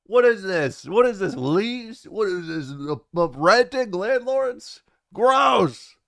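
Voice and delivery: loud, high, drawn-out disgust; mock outrage. Real, not acted. Streamer scoffing at viewers' pronunciation of Signalis, mock outrage. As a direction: exclaim it in high, exaggerated disbelief.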